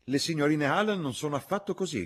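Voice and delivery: high-pitched indignant objection; young woman's heated protest; protesting cry. A narrator's reading. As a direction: cry out in their defence. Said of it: "gridò Lucy".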